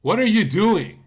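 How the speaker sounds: loud exasperated what are you doing; exasperated yell. Real, not acted. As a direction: shout the question in disbelief. Exasperated at Sonny.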